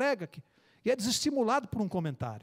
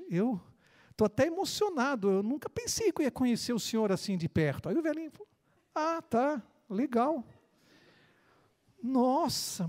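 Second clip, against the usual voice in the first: put-on higher child voice, eager and stumbling; excited little boy; 6 semitones higher than usual. Little boy gushing to the old man on the bench. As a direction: gush like a starstruck kid.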